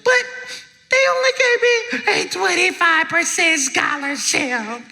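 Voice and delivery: hammed-up crying girl; tearful student. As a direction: complain through exaggerated tears. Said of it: "and she's crying".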